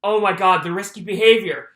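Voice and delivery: ordinary delivery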